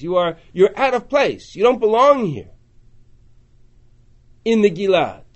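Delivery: loud contemptuous jeer at Ephraim; taunting Gilad. Acted. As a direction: jeer them out of the land. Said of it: "it's Gilad saying".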